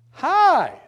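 high, loud, sugary greeting; sweet old lady. Retold or imitated. Patient greets him sweetly.

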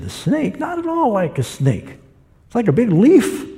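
second blind man scoffing, pitched high. Retold or imitated. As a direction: pitch it up high and brush off the snake idea.

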